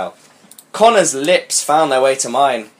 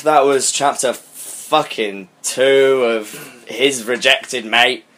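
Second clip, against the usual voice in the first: drawn out, slow and scornful; slow disdain; 4 semitones lower, slower than usual. Real, not acted. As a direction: drag it out with contempt. Dismissive wrap-up.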